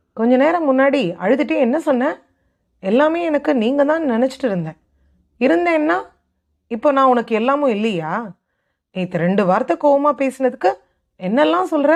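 a husband accusing his wife, pitch climbing; angry confrontation. Acted. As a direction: demand an answer, voice rising in hurt anger.

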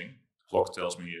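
ordinary talk, nothing marked

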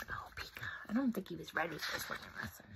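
hushed, fast whisper; a quick hushed aside. Real, not acted.

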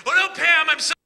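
high, loud mock-terrified pleading; sarcastic mock panic. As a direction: ham up being scared of socialists to ridicule Pam. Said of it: "not to be sarcastic".

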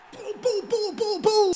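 quick high pu-pu-pu beats; high staccato pu sounds. Real, not acted. Streamer vocalises each quick pass. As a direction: tap out each pass with your voice. Mimicking passing rhythm.

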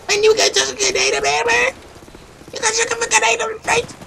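squeaky-high outraged protest; high-pitched voice. Acted. Player protesting a grenade threat, high-pitched voice. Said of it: "why the fuck you doing some high-pitched ass voice?".

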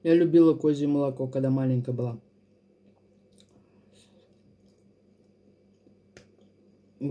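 food in mouth; eating between words; talking while chewing. Real, not acted. Seen 1: Eats throughout, fork with food at mouth while speaking.

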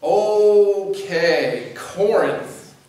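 normal talking voice

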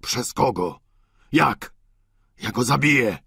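put-on low, furious old man's cry; father threatening to kill for his daughter; shouting in rage. A narrator's reading. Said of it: "krzyknął ojciec Goriot".

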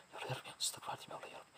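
hushed frightened prayer; terrified whisper of prayer. Real, not acted. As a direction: pray under the breath in fear.

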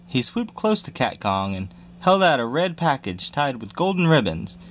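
plain reading voice

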